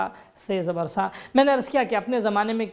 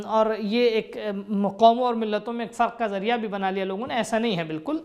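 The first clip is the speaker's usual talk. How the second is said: usual speaking voice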